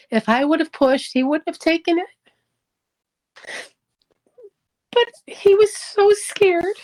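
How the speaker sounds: halting, choked up, fighting back tears; real tearful voice. Real, not acted. Wife regretting she didn't push her husband, tearful. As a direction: voice climbing high, close to crying.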